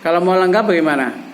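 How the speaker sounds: ordinary talk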